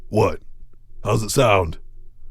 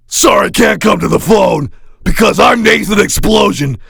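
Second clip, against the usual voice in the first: rock star voicemail shout; 6 semitones higher, slower than usual. Acted. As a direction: stretch the last words out, very slow and high. Second take of voicemail greeting.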